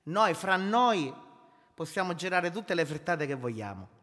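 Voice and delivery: normal talking voice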